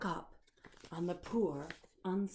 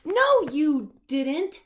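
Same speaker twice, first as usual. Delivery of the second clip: high, loud, slow snapping retort; loud slow snap; snapped; 9 semitones higher, 13 dB louder, much slower than usual. Acted. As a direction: snap back at the monster. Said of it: "snapped Sam".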